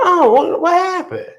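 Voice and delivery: very high, put-on questioning voice; high, eager act of asking what's up; high-pitched mock curiosity. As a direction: retell your question in a high squeak. Retelling her question to coworker.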